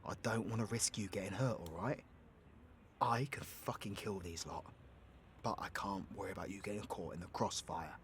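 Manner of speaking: low, soft pleading explanation; earnest, hushed. Acted. Warning partner they could get hurt, hushed and earnest. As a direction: keep it low and soft, reasoning with a loved one.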